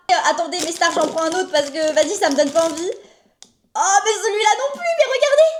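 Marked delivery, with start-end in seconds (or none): 3.7-5.5 high outraged exclaim; shocked by the ugly radish; surprised. Real, not acted. Disgust at radish.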